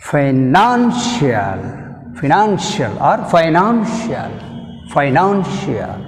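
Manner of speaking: financial, slow, stress on nan; stress demo. Shown as a demonstration. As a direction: say financial slowly, leaning on nan. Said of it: "Stress mark on the N". (నాన్ మీద ఒత్తిడి)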